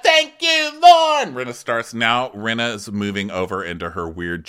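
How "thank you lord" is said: high, loud mock thanks to the Lord; hammed-up squeal of relief; mock high-pitched praise. Rinna mocking Garcelle, high mock praise. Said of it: "She goes".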